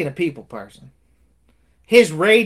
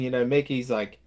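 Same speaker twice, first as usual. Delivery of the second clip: ordinary talk, nothing marked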